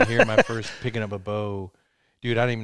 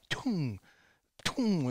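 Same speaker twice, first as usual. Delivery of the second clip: arrow sound; 6 semitones higher, 3 dB quieter than usual. Retold or imitated. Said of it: "I'm like".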